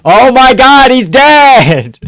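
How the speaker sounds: high, loud disbelieving cry; stunned by a brutal knockout; real alarmed fan yell; shocked exclaim. Real, not acted. Knockout reaction.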